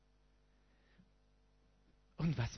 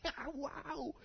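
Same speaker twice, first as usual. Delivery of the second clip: mock elation; 11 semitones higher, 5 dB quieter than usual.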